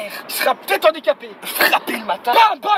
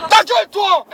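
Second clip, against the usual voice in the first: much louder yell of shut up; shouted shut up; 3 semitones higher, 8 dB louder, slower than usual. Acted. Driver yelling shut up at his complaining passenger. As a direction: bark it at the passenger in mock rage.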